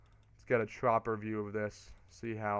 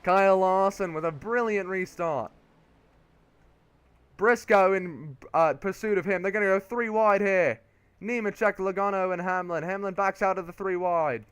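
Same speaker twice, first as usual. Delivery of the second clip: higher, excited Australian play-by-play; Australian race commentator; 7 semitones higher, 6 dB louder than usual. Pretend. Commentator calling a brilliant restart, excited Australian accent. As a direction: call it like an Australian TV announcer. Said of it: "Why am I Lee Diffie".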